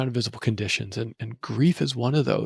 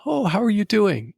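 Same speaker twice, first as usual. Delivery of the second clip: high, bright social "how are you" in a mock voice; chirpy small-talk greeting; 9 semitones higher than usual. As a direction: imitate a cheery acquaintance's greeting. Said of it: "someone had asked".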